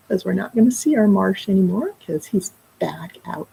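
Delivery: ordinary delivery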